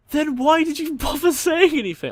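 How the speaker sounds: high, loud laughing disbelief; incredulous outburst. Retold or imitated. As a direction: yell it half laughing in disbelief. Friends baffled by prankster.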